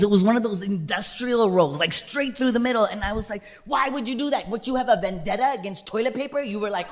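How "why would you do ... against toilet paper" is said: high-pitched comic disbelief; exasperated questioning. Acted. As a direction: plead with the culprit, mock-aggrieved. Berates absent culprit in story.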